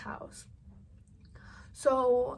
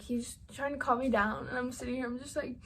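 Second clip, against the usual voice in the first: usual speaking voice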